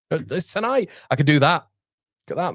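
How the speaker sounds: usual speaking voice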